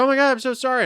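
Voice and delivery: high-pitched flustered sorry, lightly imitated; high mock apology. Traveller mimicking bystanders apologizing after an old lady fell, high. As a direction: squeak out a fake-sounding apology. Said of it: "these people are like".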